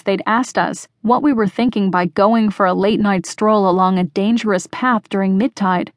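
plain reading voice

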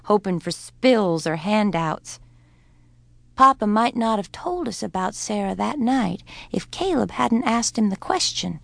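ordinary delivery, nothing marked

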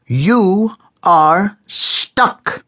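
high, very slow, pointed delivery; stern Jung. A narrator's reading. Jung pins Moses down with a slow, high verdict. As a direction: lean on each word like a verdict.

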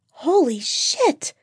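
high, drawn-out stunned exclamation; hissed shock. A narrator's reading. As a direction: hiss it slowly in high-pitched shock. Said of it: "Jennifer hissed".

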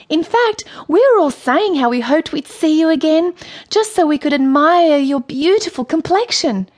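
high, sweetly deferential character voice; flattering Courtney. A narrator's reading. Courtney keeps flattering the queen.

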